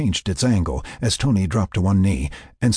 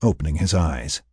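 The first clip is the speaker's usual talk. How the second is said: usual speaking voice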